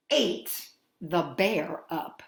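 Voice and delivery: plain reading voice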